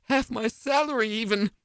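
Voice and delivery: ordinary delivery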